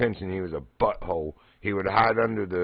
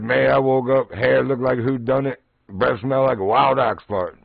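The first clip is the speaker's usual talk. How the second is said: lightly voiced neighbor, louder; imitating the neighbor; 4 semitones higher, 7 dB louder than usual. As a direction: retell his line louder, with gusto. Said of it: "He said".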